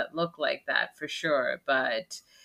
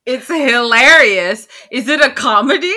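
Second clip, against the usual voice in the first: indignant defense; 5 semitones higher, 8 dB louder than usual. Real, not acted. Defending the movie against co-host.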